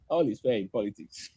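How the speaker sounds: low, soft smug quote; mock politician. Mimicking the man in the villa, low and smug. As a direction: drop low and quiet, smug. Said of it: "he's saying".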